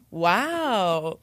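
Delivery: imitating an encouraging older woman, drawn out; a long, swooping mock-impressed wow. Older woman worker encouraging two singing kids, encouraging. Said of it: "to, like, encourage them".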